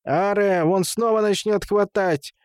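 high, scratchy protesting voice; creaky sandpaper voice. A narrator's reading. Woman holding Темул's ear objects to Гулям, creaky high protest. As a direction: rasp out the complaint at a high pitch. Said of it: "сказала она скрипучим, как наждак, голосом".

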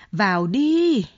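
a loud yelled order; yelling. A narrator's reading. Kiều Oanh yells at Đẹn to come in, loud yell. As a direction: bellow it at him to come in. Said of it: "bà Kiều Oanh gào lên".

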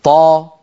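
طا said open and easy; voweled taa. Shown as a demonstration. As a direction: let طا flow with its vowel. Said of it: "نجرب في حرف الطاء". (طاء متحركة بلا ضيق)

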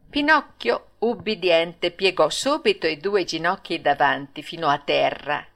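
ordinary delivery, nothing marked

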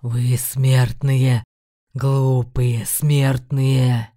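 low, slow thunderous demon roar. A narrator's reading. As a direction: roar at the mortals slowly. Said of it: "Крик сотряс стены пещеры". (громовой рёв демона)

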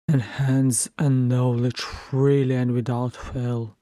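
grave, measured royal address; stern old king. Acted. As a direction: speak as an aged king rebuking his council, weighing each phrase. King Brand's speech to the council.

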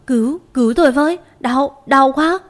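high, loud, fast cry for help in pain; desperate shout for help. A narrator's reading. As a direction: yell out for help through the pain. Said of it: "cố dùng sức hét lên thật to".

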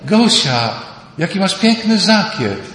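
high, loud gushing cry to a friend; mock-gushing compliment; gushing admiration. Mimicking women's admiring cry.

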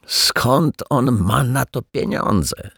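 slow, high puzzled question; surprised. Acted. Janitor puzzled about the neighbour's money, asking slowly and high. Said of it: "zdziwił się pan Mietek".

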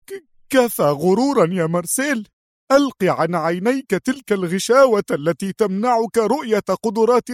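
high, trembling, urgent female voice; nervous yelling. A narrator's reading. Sonia scolding Marcel's arrogance, high and nervous. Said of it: "وهي تصرخ في عصبية".

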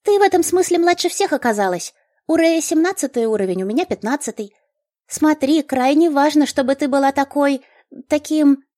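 high, eager gnome voice; chirpy gnome explaining. A narrator's reading.

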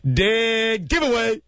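shouted catchphrase. Shown as a demonstration. Host shouts the catchphrase in a battle with the guest. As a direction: yell Dead Giveaway, dragged out. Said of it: "Let's have a battle".

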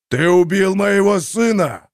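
slow, loud booming spirit voice; thundering spirit voice. A narrator's reading. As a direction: boom it out like rolling thunder. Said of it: "Низкий ракочущий бас, похожий на непрерывный раскат грома".

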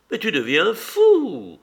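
high, loud, slow cry of annoyance; vexed outburst. A narrator's reading. Kitty cuts off Levin's doubts, vexed outburst. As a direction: snap at him that he's mad. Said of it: "rouge de contrariété".

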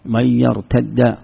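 slow من يرتد with one doubled dal; merged form. Shown as a demonstration. Teacher repeating the verse word slowly, merged dal. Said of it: "يا أيها الذين آمنوا من يرتد".

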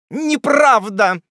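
high, loud, drawn-out protest; indignant denial. A narrator's reading. The spinster aunt denies her age, indignant. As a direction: deny it with wounded indignation. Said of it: "отвечала с негодованием".

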